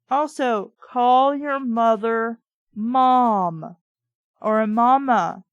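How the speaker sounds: loud, slow, firm correction; emphatic scolding. Real, not acted. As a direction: lecture her, drawing each word out. Reader scolds the character.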